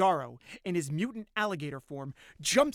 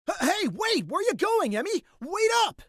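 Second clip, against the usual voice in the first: high, loud stammered shout; alarmed calling out; 9 dB louder than usual. Acted. Boyfriend chasing Emmy into the night, alarmed shouting. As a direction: yell after someone running away. Boyfriend calls after fleeing girlfriend.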